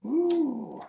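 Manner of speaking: ordinary delivery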